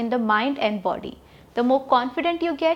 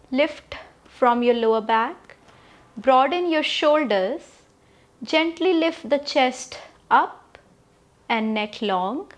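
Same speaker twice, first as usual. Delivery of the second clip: slow, measured meditation instructions with long pauses; guided meditation; 3 dB quieter, slower than usual. Acted. Yoga teacher settles the class into upright posture, guided meditation. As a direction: guide the body gently, phrase by phrase.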